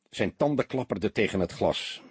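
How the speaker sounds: plain reading voice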